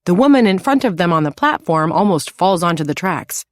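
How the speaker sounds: usual speaking voice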